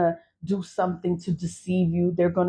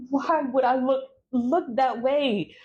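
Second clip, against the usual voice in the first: mock disgusted; 6 semitones higher than usual. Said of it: "I'm like".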